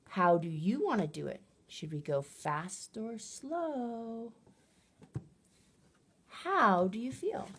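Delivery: put-on lively, high questions to a child, slowed down; animated questions. Shown as a demonstration. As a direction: make each question inviting. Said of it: "and I might say".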